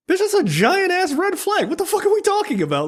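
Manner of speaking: very high, disbelieving outburst; high-pitched what-the-hell voice; incredulous squeal. Retold or imitated. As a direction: squeak it out in mock outrage. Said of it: "I'm like".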